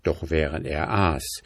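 usual speaking voice

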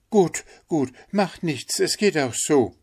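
high, hasty obliging call; Hans calling out. A narrator's reading. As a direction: reassure the miller it doesn't matter. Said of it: "rief der kleine Hans".

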